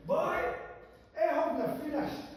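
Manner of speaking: high, loud cheeky shout; defiant Dundee boy. Acted. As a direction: cut him off, not done yet. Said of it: "This is proper".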